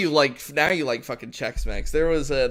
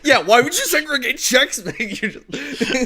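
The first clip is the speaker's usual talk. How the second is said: high, loud question through laughter; laughing incredulously; 12 semitones higher, 9 dB louder than usual. Real, not acted. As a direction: crack up while asking in disbelief. Cracks up over segregated Chex Mix.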